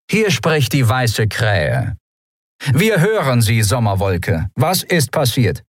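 measured, commanding radio reply; captain answering a distress call; slow, authoritative captain. Acted. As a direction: take charge of the call.

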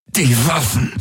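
high, pained gasp; gasping through clenched teeth. A narrator's reading. Wounded man orders friends to arm, gasping. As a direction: squeeze it out through gritted teeth. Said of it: "keuchte er mit zusammengepressten Zähnen".

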